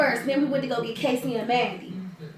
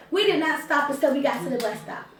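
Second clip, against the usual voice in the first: usual speaking voice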